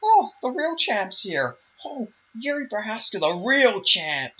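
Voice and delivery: high, slow, gushing mock fanboy; sneering parody of a pundit praising a champ; mocking Ariel Helwani. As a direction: drag it out like a sycophantic interviewer. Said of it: "you have fucking Ariel fucking Smelwani".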